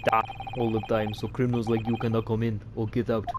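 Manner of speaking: put-on gruff Russian accent; rough Russian accent. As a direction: boast like a Russian guard. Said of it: "I like to do Russian accents".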